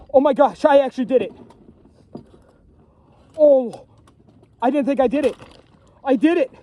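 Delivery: high, loud cries of disbelief; overjoyed shouting. Real, not acted. Trapper telling viewers about his first beaver, ecstatic high shouting. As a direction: shout out the joy of a first catch. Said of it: "I'm so excited right now".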